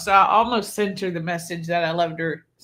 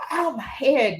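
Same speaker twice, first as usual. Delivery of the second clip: higher, hammed-up hurt cry; mock pain; 6 semitones higher, slower than usual. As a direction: pretend she hurt you, playfully. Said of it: "I'd pretend like she had hurt me".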